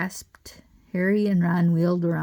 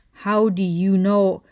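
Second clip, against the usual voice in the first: spluttering; 6 dB louder, slower than usual. A narrator's reading. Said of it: "she spluttered".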